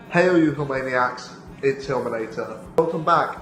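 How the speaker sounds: normal talking voice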